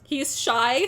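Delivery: ordinary talk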